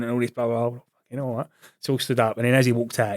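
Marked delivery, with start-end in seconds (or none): none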